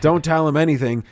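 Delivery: half-laughing mock yell; retold yelling. Warning Lee to save the story, retold yelling. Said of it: "I was yelling at Lee".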